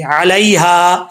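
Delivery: علیہا slowly, ya not stretched; slow demo. Shown as a demonstration. Teacher models علیہا very slowly. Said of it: "لمبا کر کے نہیں پڑھیں گے".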